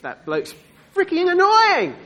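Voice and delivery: loud, high-pitched annoyed outburst; hammed-up exasperation; exasperated bystander. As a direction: complain loudly about a noisy neighbour. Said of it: "the people near him are going, "Good grief".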